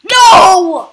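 shouting. Real, not acted. Panicked gaming moment.

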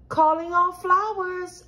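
high, sustained summons; calling out. A narrator's reading. Girl summoning her flowers to bloom, high call.

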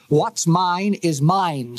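slow, deliberate, each word weighed; slow insistence. Acted. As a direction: lean on every word, slowly. Lowry repeats his claim.

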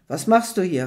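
ordinary delivery

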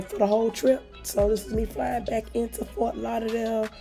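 normal talking voice

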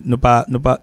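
usual speaking voice

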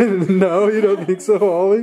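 high, loud cooing at the dog. Real, not acted. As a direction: gush over the cute dog. Talking to dog.

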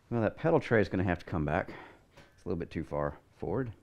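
ordinary talk, nothing marked

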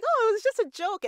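high, fast, breezy brush-off; mock dismissive friend. Host imitates a friend laughing off a dig, high and quick. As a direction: toss it off like it's nothing. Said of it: "You're like".

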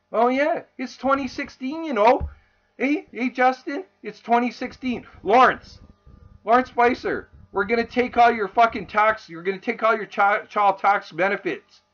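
high, mocking sing-out at officials; sarcastic mockery. Mocks officials taking his benefits.

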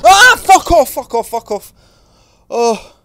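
very high, very loud shrieks; real startled scream. Real, not acted. Player when the thing jumps out in front of him, screaming. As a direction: shriek to drive it away.

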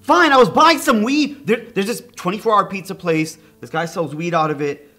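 high, loud, panicky blurting; panicked confession. Acted. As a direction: crack under pressure and spill it. Suspect cracks under pressure.

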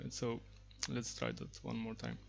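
ordinary talk, nothing marked

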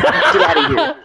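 laughing high "get outta here". Real, not acted. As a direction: tease a friend while laughing. Laughing dismissal of friend.

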